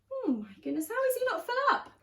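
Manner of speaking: high, fast astonished aside; amazed aside. Real, not acted. Reader to the child listener, amazed. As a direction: marvel at the greedy dino. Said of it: "reader aside".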